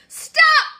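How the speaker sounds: high, loud stop order; a high, loud royal shout; commanding shout. Acted. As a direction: bark it at him across the hall. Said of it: "she cried".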